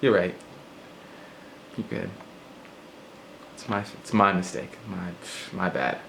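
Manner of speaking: soft, small backing-down voice; softly conceding to avoid a fight; mock meek apology. Shown as a demonstration. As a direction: shrink and apologize quietly. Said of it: "So I'm gonna go like this".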